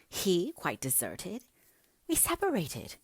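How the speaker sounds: slow disbelieving echo leaping high on the second question; indignant. A narrator's reading. Catherine challenging talk of being separated from Heathcliff, indignant. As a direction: throw the words back in outrage, pitch soaring. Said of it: "with an accent of indignation".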